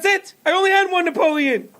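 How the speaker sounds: high distressed mother explaining to storekeeper; distraught mother. A narrator's reading. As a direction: lay out her loss in a high frantic voice. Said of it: "She said".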